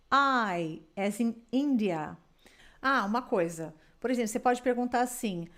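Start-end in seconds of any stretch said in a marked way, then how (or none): 0.1-2.2 slow, carefully spelled out letter by letter; slow spelling demo. Shown as a demonstration. Teacher spelling a word slowly for her student. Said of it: "se eu fosse soletrar nap".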